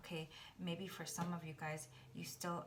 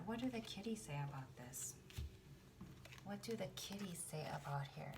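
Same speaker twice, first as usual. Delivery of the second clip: soft unvoiced whisper; 3 dB quieter than usual. Real, not acted. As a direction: whisper the question to the cards. Whispering to the cards.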